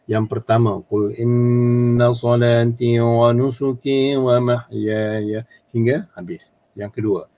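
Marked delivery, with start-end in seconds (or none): 0.8-5.4 Quran recitation. Shown as a demonstration.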